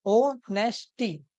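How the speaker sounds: "ओनेस्टी" very slowly, in three separate parts; split into syllables. Shown as a demonstration. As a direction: say "ओनेस्टी" as o... nest... tee. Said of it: "ऑनेस्टी को हम कैसे कर सकते हैं". ("ओनेस्टी" को तीन हिस्सों में, धीरे)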